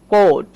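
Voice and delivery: কোট with an o vowel; model word. Shown as a demonstration. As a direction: read কোট like coat. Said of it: "ও plus এ রয়েছে".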